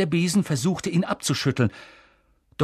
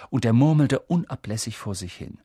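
ordinary delivery, nothing marked

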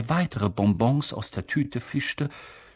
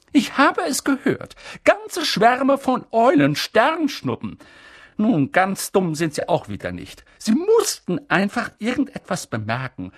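McGonagall voice; 7 semitones higher, 4 dB louder than usual. Acted. McGonagall complaining.

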